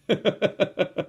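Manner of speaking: short rapid chuckle; high, quick burst of laughter. Real, not acted. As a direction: laugh to yourself briefly. Laughs after his own remark.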